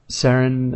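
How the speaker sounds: Saren said "SARR-en"; speaker's preferred reading. Shown as a demonstration. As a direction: say Saren with a short first vowel. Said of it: "instead of Saren".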